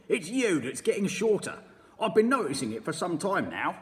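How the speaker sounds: sneering husband, loud; mean old husband taunting his wife; Mr Twit. Acted. Mr Twit's dialogue.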